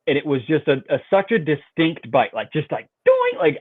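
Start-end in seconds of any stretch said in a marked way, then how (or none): 3.0-3.3 high-pitched twanging "doing"; high springy boing. Retold or imitated. Angler imitating the sudden bite, high twangy boing. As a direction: imitate a springing line in one high ringing note. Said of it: "I can only describe it with that word".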